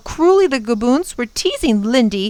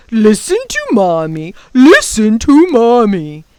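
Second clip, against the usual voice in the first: mocking gaboon; 7 dB louder than usual. A narrator's reading. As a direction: taunt like a delighted monster. Gaboons jeer at the scolding.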